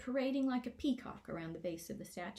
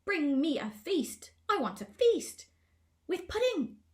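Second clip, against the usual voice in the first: demanding shout; 10 semitones higher, 8 dB louder than usual. A narrator's reading. Gnome king demanding a feast from Twig, loud greedy shout. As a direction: whine for pudding like a spoiled child. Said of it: "As Glimfinkel shouted".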